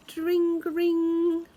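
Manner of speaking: high bright dring ring; bicycle bell. Retold or imitated. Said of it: "bicycle bells ringing".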